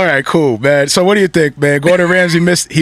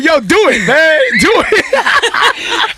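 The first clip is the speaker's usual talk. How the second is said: excited hype; 7 semitones higher, much slower than usual. Retold or imitated. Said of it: "He said".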